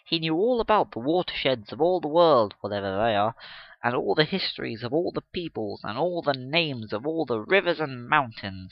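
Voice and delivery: ordinary delivery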